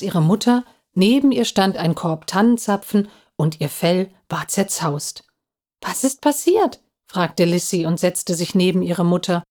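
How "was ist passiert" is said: put-on high, anxious child's question; small high worried voice. A narrator's reading. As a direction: ask in mild alarm, pitch rising. Lissy finds her mother dishevelled.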